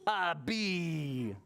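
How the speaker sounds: plain reading voice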